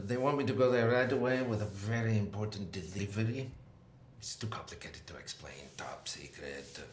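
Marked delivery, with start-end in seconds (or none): 4.2-6.8 low, soft, breath-only confiding; hushed secrecy. Acted. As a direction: drop to a confidential near-whisper.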